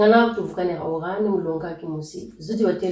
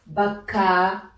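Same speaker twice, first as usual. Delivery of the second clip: "baka" with the last a held long; drawn-out vowel. Shown as a demonstration. Teacher showing learners the Lari example, final a of "baka" drawn out. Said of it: "Certes, on va traîner".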